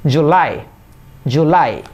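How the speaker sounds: July with weight on "LAI"; stress on L. Shown as a demonstration. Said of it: "এল এর সাথে pressure দিবেন". (জুলাই-এর "লাই"-এ জোর)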